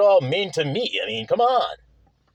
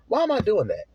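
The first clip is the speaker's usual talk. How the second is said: ordinary talk, nothing marked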